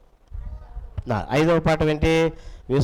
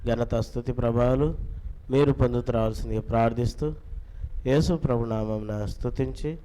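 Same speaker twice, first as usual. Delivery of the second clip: low, softer prayer voice; 4 semitones lower, 6 dB quieter than usual. Real, not acted. Teacher closing a prayer before the children's Bible class, praying. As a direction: pray in a lowered, even voice.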